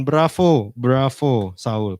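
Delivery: slow mocking bravo, loud; sarcastic bravo. Real, not acted. Preacher mocking Saul before the congregation, slow sarcastic praise. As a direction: applaud Saul with heavy irony. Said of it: "sarcastic applause".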